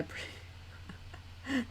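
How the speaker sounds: breathy unvoiced whisper. Real, not acted. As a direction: whisper it under your breath.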